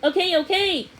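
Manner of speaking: high, loud, rushed woman's voice; hurried lady. A narrator's reading. Lady hurrying to drop off the puppy, rushed and high. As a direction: gush it all out in a flustered rush.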